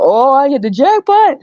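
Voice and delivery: high, slow, giddy man's voice; excited foreign man. Retold or imitated. Man telling himself he hit the jackpot, giddy high voice. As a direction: gloat over the jackpot.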